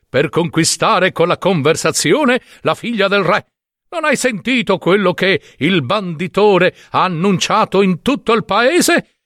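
haughty brotherly retort; condescending reply. Acted. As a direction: explain it as if he should know. Brothers answer the simpleton condescendingly.

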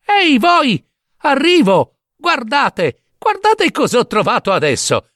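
high-pitched gleeful hollering; bursting to show off, very high voice; excited calling. Acted. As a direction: get the attention of riders ahead. Calling again to brothers ahead.

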